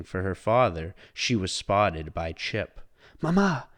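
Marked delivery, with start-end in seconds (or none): 3.2-3.7 put-on higher, eager child voice; excited child. A narrator's reading. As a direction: rush to tell mother. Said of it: "announced Chip excitedly".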